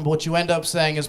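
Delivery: ordinary talk, nothing marked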